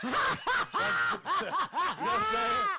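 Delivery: high, loud and slow, laughing through the words. Real, not acted. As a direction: laugh the question out. Laughing through his punchline.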